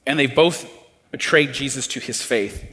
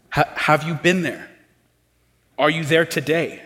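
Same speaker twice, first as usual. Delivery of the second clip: ordinary talk, nothing marked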